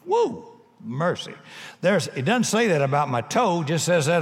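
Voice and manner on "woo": short, high-pitched "woo"; high whoop. Real, not acted. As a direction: let out a quick high exclamation. Reacting to the scripture just read.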